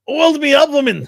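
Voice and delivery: loud, high-pitched cartoon accent; Groundskeeper Willie. Podcaster riffing on an oiled-up figure, loud Groundskeeper Willie impression. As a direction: holler it in a big put-on character voice. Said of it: "Groundskeeper Willie ... What, your accent?".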